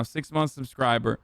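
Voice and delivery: ordinary talk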